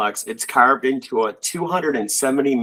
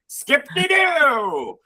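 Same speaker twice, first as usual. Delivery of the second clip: playful singsong; 13 semitones higher, much slower than usual. Acted.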